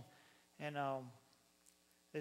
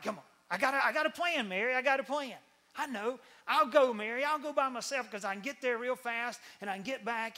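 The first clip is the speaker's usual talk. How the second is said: loud, high, eager husband; Joseph; 5 semitones higher, 8 dB louder than usual. Acted. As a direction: pitch the plan urgently, loud and high. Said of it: "And so Joseph's like".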